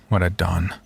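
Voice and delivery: ordinary delivery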